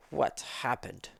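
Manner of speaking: usual speaking voice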